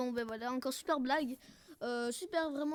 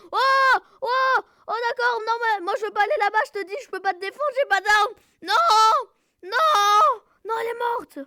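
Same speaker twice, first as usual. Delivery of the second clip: high, loud alarmed cries; frantic, high-pitched protest; panicked wailing; 7 semitones higher, 10 dB louder than usual. Real, not acted. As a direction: shout in panic over a fallen teammate. Panicking as teammate Brigitte dies.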